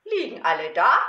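playful, slow, drawn-out verse with big swings; children's poem recitation. Acted. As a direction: recite it theatrically for small children.

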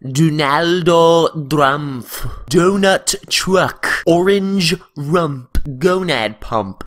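very slow, loud, stretched words; slow vocal warm-up. Shown as a demonstration. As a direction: draw each word out loud. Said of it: "how I warm up before my videos".

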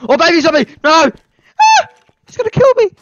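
very high, loud shriek; terrified scream. Real, not acted. Baby zombie attack.

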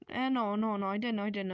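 ordinary delivery

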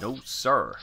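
put-on a light American accent echoing the game voice. Repeating the airman's reply in a lightly put-on American accent. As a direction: parrot the line in a mild American accent. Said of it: "Distinctly American sounding accent".

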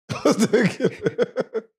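slow, laughing repeat of the line. Real, not acted. Host repeating the kid's line to the guest, laughing. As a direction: laugh it out, enjoying the joke. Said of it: "repeats the line laughing".

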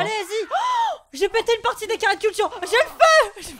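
high loud frantic cries; excited shouting. Real, not acted. House on fire, chaos.

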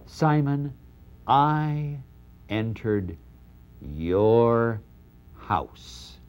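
each word set apart, slow and heavy; I, entered, your, house, spaced out and fading to a hush; measured rebuke. Acted. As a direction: let every word drop alone like a verdict, ending in a whisper. Jesus confronting Simon, slow.

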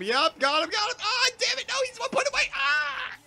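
very high, loud, frantic shouting; real gamer's panic, rising to a squeal; frantic excitement. Real, not acted. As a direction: shriek it as victory slips away. Frantic near kill in game.

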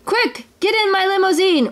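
high-pitched, hurried-sounding command; frantic storybook command; urgent order. A narrator's reading. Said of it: "ordered Scrooge to his driver".

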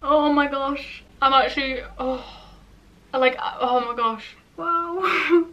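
stunned, drawn-out amazement; dramatic disbelief. Real, not acted. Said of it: "I need to stop being dramatic".

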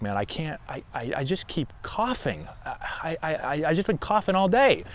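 softly voiced patient. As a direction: mumble it like a worn-out patient who can't stop coughing. Player voiced as coughing patient.

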